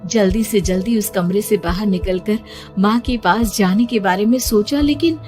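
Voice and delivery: ordinary delivery, nothing marked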